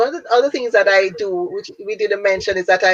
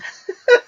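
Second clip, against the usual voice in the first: high laugh; 3 dB louder than usual. Real, not acted. Amused at own phrase.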